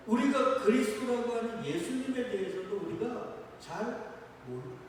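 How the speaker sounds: normal talking voice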